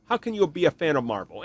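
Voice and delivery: ordinary talk, nothing marked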